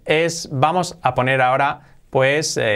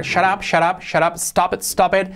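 quick run of commands, pitched high; rapid-fire; 6 semitones higher than usual. Acted. Teacher redoing Homer's fastest part, high rapid-fire delivery. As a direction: fire off the commands back to back, higher. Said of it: "la parte que dice más rápido Homer".

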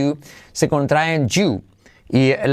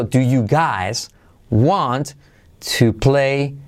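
"do you guys... want... to play... here" in separated pieces; slow, careful textbook separation of "do you guys want to play here"; word by word; much slower than usual. Shown as a demonstration. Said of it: "Así sería separado".

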